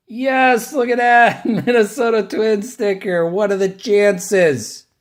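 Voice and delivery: high, loud delighted cry with a laugh; overjoyed, voice up high and loud; excited. Real, not acted. Pulls Twins sticker, delighted.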